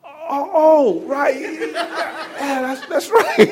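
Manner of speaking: high, laughing sheepish agreement; husband caving with an embarrassed laugh. Retold or imitated. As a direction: back down embarrassed. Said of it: "I was like".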